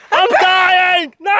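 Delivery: high, crying mock shout; howling over a sunburn; hammed-up wail. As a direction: overplay the agony like a silent-film heroine. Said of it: "Scottish people would be like".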